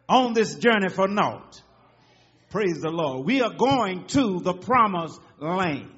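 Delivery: loud, rising sermon cadence; full-voiced church preaching; fervent preaching. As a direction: preach it out to the congregation.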